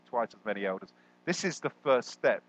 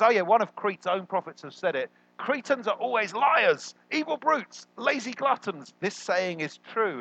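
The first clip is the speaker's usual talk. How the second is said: quoting Paul; 8 semitones higher than usual. Retold or imitated. Preacher retelling Paul's Cretan quote, high and mock-solemn. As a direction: ham up the pompous proclamation. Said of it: "And he says".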